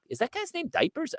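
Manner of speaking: put-on high incredulous girl voice; girls asking. Girl at the bar asking about nickname, high puzzled voice. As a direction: ask it high like a puzzled young woman. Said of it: "girls would be like".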